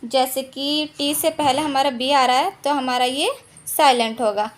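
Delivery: usual speaking voice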